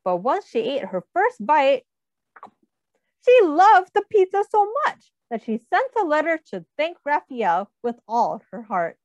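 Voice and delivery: plain reading voice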